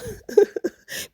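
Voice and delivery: a sudden high burst of laughter. Real, not acted. Laughing at the housemate nickname, real high laugh. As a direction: crack up at the nickname.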